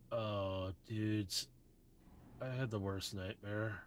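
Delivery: lazy drugged beach bum; drowsy surfer dude. Acted. GM voices drugged surfer Drift.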